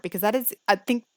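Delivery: usual speaking voice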